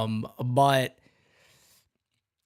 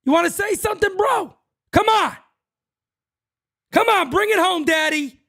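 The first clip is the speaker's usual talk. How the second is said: higher, louder mock provoking umpire; taunting umpire; 4 dB louder than usual. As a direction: goad him like an umpire itching for a fight, higher and louder. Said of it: "The umpire looked at you and said".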